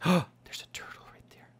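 gasp, then whisper in wonder; hushed gasp. Real, not acted. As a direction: whisper it after a sharp gasp. Gasps on spotting a turtle.

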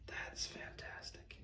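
slow, very soft whisper of amazement; awed whisper. Real, not acted. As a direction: whisper it slowly, stunned. Awed at the reaper figure.

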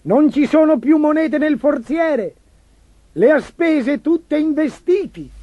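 high screeching chancellor, slowing at the end; a shrill, alarmed official wailing about the empty treasury; shrieking chancellor. A narrator's reading. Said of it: "strillava il cancelliere".

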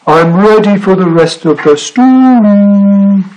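slow, high sing-out with drawn-out ending; calling out. A narrator's reading. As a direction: holler it to someone out of sight. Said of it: "he called".

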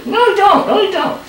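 high, whiny puppet voice; stubborn little dummy refusing; squeaky ventriloquist dummy. Acted.

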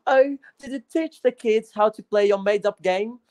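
ordinary talk

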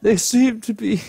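giggly, breaking up mid-line; laughing through the lines; giggling. Real, not acted. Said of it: "I'm all giggly".

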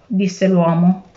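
plain reading voice

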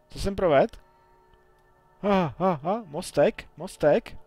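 high, loud, laughing; delighted at the bridge appearing; thrilled gamer cheer; excited laugh. Real, not acted.

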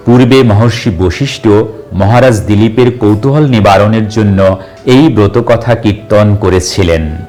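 usual speaking voice

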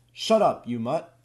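sudden loud bark at the mutt; yelling at the dog. A narrator's reading. Todd yells at the barking dog, loud. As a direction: yell at the dog, then drop. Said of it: "And then yells".

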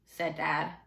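plain reading voice

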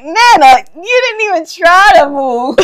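loud, high, laughing exclamation; mock incredulous. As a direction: exclaim it at Nana in comic disbelief. Acting their reaction to Nana.

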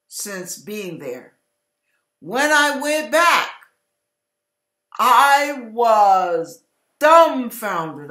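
ordinary talk

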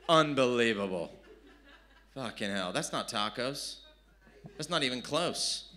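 ordinary talk

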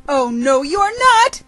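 high, loud protest, climbing sharply; worm's squealed refusal, rising high; crying out. Acted. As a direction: cry out in storybook alarm. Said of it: "the worm cried".

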